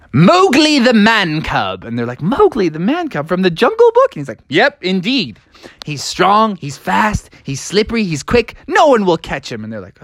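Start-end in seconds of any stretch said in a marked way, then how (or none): 0.1-1.8 big high-pitched, drawn-out name reveal; slow, proud proclamation in a raised voice; triumphant announcement. Acted. Froggy's grand pick reveal. 2.2-4.2 high-pitched, disbelieving echo; baffled friends double-checking; incredulous question. Acted. Friends question the odd pick. 4.5-9.6 confident boasting. Acted. Froggy boasts about his pick.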